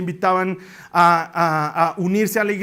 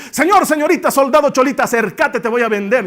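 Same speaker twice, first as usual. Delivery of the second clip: loud, high market cry; La Paz street vendor loudly calling to shoppers; street vendor hawking; 4 semitones higher, 5 dB louder than usual. Retold or imitated. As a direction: hawk your wares to everyone passing. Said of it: "a gran voz".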